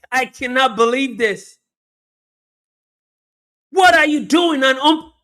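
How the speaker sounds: very high, loud screaming play-by-play; frantic commentator shout. Real, not acted. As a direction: build from excited call to incredulous screaming at the player. Live call of a missed chance.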